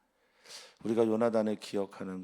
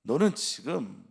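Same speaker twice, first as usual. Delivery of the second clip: angry accusation; 2 semitones higher, 6 dB louder than usual. Acted. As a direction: demand it like a suspicious king. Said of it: "이렇게 말하는 거예요".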